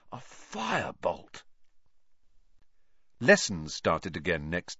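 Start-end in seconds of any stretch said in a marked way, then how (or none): 0.1-1.4 slow, softer, savouring the word; almost a reverent sigh; awed. A narrator's reading. Wood savours the word dreamily.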